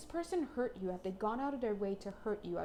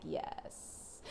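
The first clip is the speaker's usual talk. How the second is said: breathy hushed yes; a higher self whispers a reply to the reader, hushed; whispered yes; 8 dB quieter than usual. Pretend. Soft mystical whisper.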